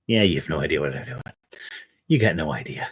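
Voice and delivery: ordinary talk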